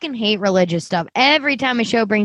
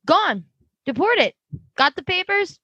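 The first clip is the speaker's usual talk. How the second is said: higher, breezy brush-off as the official; dismissive constable; 6 semitones higher than usual. Retold or imitated. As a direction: toss it off like a bored clerk. Constable brushing off a panicked man.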